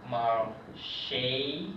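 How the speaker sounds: "marché" with a bright French a and a closed é ending. Shown as a demonstration. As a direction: say "marché" the French way, stress at the end. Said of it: "used to make the A sound".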